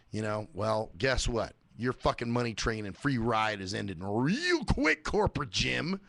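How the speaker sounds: normal talking voice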